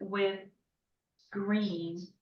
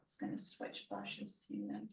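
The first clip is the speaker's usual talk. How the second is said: whispered; 13 dB quieter than usual. Real, not acted. Unvoiced aside.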